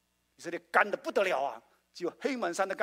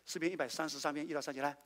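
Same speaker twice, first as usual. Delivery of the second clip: usual speaking voice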